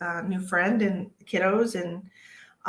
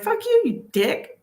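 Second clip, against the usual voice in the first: high, loud, drawn-out comeback; mock retort; 14 semitones higher, 5 dB louder than usual. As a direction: toss out an imagined insult in a pitched-up voice. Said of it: "Or I'd be like".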